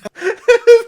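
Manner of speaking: big high burst of laughter; sudden loud laugh. Real, not acted. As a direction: crack up out loud. Burst of laughter at own joke.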